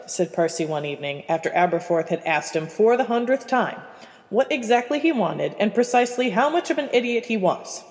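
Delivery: plain reading voice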